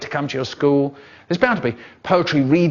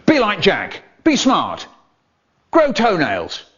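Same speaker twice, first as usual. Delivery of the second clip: higher, louder punchy ad tagline; advert slogan; 6 semitones higher, 3 dB louder, slower than usual. Pretend. Ad announcer closing the spoof advert. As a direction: sell it hard to finish the spot.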